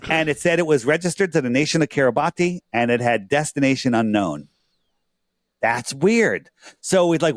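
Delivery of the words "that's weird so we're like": ordinary delivery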